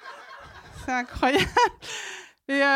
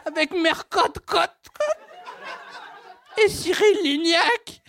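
slow, laughing sob-like squeal; ovary voice laughing; 3 semitones higher, much slower than usual. Acted. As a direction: drag it out, laughing. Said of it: "j'ai fait parler mes ovaires".

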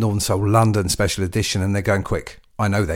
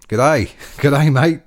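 loud, jokey Aussie greeting; hearty mock-Australian hello; Australian accent; 5 semitones higher, 6 dB louder, much slower than usual. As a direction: call it out in a put-on Australian accent. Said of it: "I won't do the Australian accent, should I?".

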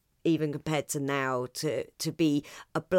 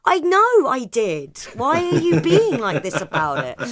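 mock cat voice; 8 semitones higher, 8 dB louder than usual. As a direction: play the cat unbothered by the fuss. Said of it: "they'll look round at you and go".

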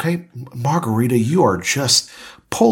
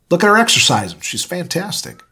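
excited; 6 semitones higher, 5 dB louder than usual. Acted. Hyping the actress.

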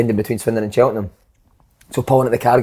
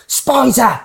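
much higher, louder, slow mock yell; touchline yell; 11 semitones higher, 3 dB louder, much slower than usual. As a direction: bellow it like a manager on the sideline. Manager yelling from the touchline.

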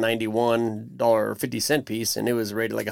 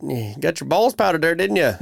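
higher, ribbing tone; teasing brother-in-law; 6 semitones higher, 4 dB louder than usual. Retold or imitated. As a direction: needle him playfully. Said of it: "that's what my brother-in-law Jake calls it".

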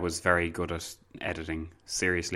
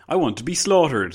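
louder, higher mock request; mock soldier; 6 semitones higher, 6 dB louder than usual. Host mocking the narrator's phrasing, playful. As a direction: say it like a silly eager volunteer. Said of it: "They didn't, like".

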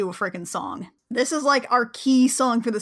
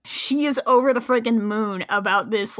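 normal talking voice

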